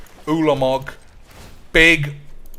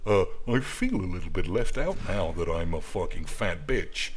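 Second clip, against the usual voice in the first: low, soft put-on voice; lower, quieter mimicry; mimicked character voice; 6 semitones lower, 6 dB quieter than usual. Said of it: "I love mimicking boys, as you will have heard".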